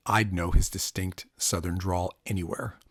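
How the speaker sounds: usual speaking voice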